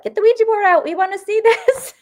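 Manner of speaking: high, loud eager pleading; excited friends. Retold or imitated. Friends begging her to bring out the Ouija board, high eager call.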